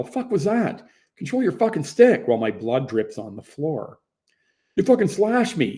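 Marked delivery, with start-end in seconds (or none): 0.0-2.3 high, loud furious yell at the guy; angry yell. Retold or imitated. Bleeding player yells at opponent, furious shout. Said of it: "and yell". 4.8-5.8 angry retort. Retold or imitated. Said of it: "he says".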